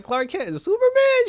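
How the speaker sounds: high, mumbled nerdy disbelief; mumbled nerdy reaction. Retold or imitated. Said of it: "that mumbled mouth, nerdy".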